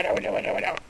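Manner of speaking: hushed whispered warning; whispering. Real, not acted. Warning the bird.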